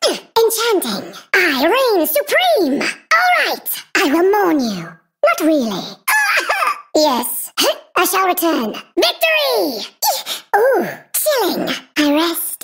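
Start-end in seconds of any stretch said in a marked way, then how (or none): 5.9-6.9 short, high burst of evil laughter; villain laugh. Acted. Villain laughs between lines.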